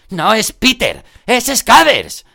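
high, loud, slowed-out yell; shouted. A narrator's reading. Ron shouting to protect his rat. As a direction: scream it in protest. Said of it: "gritó Ron".

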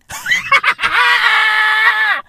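high, drawn-out laughing fit. Real, not acted. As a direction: let out a long, high cackle. Cracking up after the bit.

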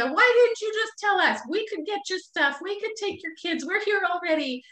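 mock-scold her like concerned friends. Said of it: "they reprimand me".

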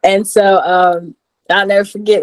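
ordinary talk

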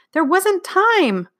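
put-on high, drawn-out child's plea; tearful protest. A narrator's reading. Girl defends herself to dad, tearful and high. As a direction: protest on the edge of tears, slowly. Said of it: "tears were ready to burst from her eyes".

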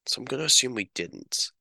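hushed, whispered conclusion; whispered decision to the viewer. Shown as a demonstration. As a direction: whisper it like a conspiracy. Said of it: "whispered aside continues".

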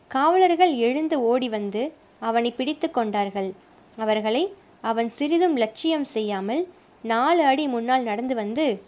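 plain reading voice

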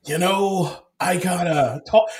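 a loose hint of a Charlie Sheen voice. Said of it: "Charlie Sheen with his, like".

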